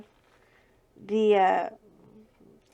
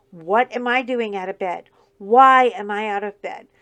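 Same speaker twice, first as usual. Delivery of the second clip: loud complaint; 11 dB louder than usual. Real, not acted. Exaggerated complaint about being up.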